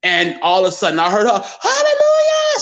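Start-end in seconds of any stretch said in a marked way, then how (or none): 1.6-2.6 high, loud joyful cry of hallelujah; praising God. Retold or imitated. Mother praising God on hearing her lost son. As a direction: shout it up to heaven in joy. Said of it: "'Cause she's praising God".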